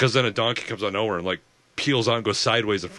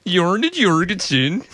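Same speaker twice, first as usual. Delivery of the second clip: slow, sing-song mock Swedish name; Swedish accent; 9 semitones higher, 6 dB louder, slower than usual. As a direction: drawl the name like a Swede. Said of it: "read the name in a Swedish accent".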